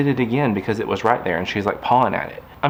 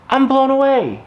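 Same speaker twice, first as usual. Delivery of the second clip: higher, louder amazement; amazed; 5 dB louder than usual. Real, not acted. Owner amazed the puppy rang the bell, higher and louder. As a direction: gush with disbelief.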